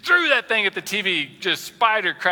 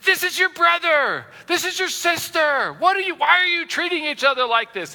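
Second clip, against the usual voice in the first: scolding parent; 11 semitones higher than usual. Retold or imitated. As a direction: play the exasperated parent breaking up a fight. Pastor voices scolding parent.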